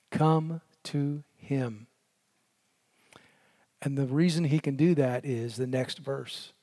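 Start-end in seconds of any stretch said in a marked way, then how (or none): 0.1-1.9 "come to him" drawn out very slowly and gently. Real, not acted. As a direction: give the single condition slowly. The one condition, very slow.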